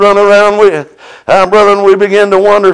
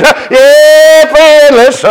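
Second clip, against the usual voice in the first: higher, louder preacher's call; preaching fervor; 7 semitones higher, 3 dB louder, slower than usual. Acted. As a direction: rouse the congregation. Preacher exhorting the congregation.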